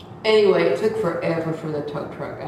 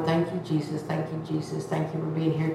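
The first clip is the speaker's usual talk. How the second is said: soft, murmured prayer of thanks; hushed, self-soothing retold prayer; calming herself down; 2 semitones lower, 8 dB quieter, faster than usual. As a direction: repeat it quietly to steady yourself. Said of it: "trying to calm myself down".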